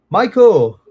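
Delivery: loud, high call of a name. Real, not acted. Teacher calls out to a student joining the class, loud and high. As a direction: call him over across the room.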